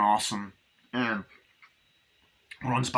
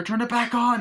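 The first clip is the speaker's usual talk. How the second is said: high, louder acted order; frantic demand; 12 semitones higher, 5 dB louder than usual. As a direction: insist like a desperate caller. Retold argument with car operator.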